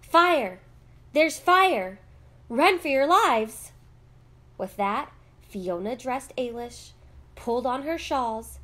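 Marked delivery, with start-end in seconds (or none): 0.0-3.7 loud, high alarm cry; urgent call-out. A narrator's reading. Voice in the street warning of fire, calling out. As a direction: shout a warning to everyone. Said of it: "they heard a voice call out".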